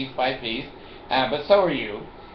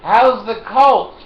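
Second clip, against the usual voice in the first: loud, slow, high taunt across the street; mocking call-out; 9 semitones higher, 12 dB louder, much slower than usual. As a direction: drawl it out loud and high to a passer-by. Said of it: "So anyway, I go".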